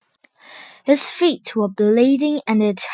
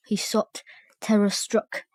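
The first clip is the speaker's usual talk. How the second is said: hushed narration; 6 dB quieter than usual. A narrator's reading.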